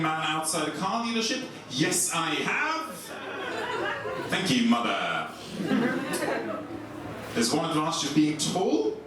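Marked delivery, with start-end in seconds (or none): none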